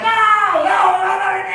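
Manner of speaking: very high, loud shouting; hammed-up high-pitched yelling; imitated panicking friends. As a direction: mimic kids shrieking in protest. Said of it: "friends like".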